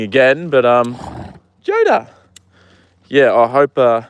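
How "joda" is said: sharp, much higher call of the horse's name. Real, not acted. Calls out to horse.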